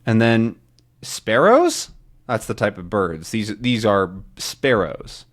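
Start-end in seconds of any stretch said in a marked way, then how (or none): 1.0-1.9 puzzled question. Acted.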